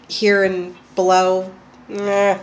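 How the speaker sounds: normal talking voice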